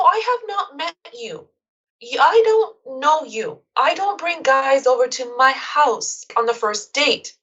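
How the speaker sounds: slow, deliberate insisting; measured, emphatic refusal; insistent. Retold or imitated. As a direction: spell it out slowly so he gets it. Insisting in retold call.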